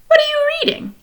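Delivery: high, bright wifely question; Mrs Popper voice. Acted. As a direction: lift the voice up high as the wife. Mrs Popper voiced in read-aloud.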